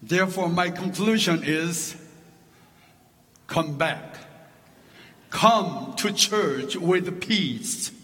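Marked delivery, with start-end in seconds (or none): none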